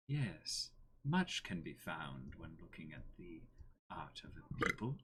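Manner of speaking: cold, hushed voice; Thrawn impression. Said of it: "best Thrawn impression".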